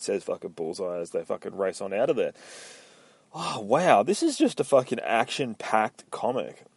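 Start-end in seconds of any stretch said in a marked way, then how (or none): none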